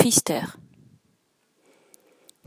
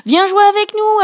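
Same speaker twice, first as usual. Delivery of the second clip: fish calling out; 12 semitones higher, 7 dB louder than usual. Acted. Fish call Rainbow to play.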